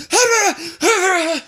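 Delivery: loud, very high gibbering cry; panicked startle. Retold or imitated. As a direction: wake up shrieking in mock panic. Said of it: "panic attack looking thing".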